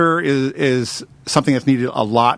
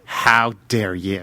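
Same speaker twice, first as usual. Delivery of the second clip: mock indignant; 6 semitones lower, 3 dB quieter, much slower than usual.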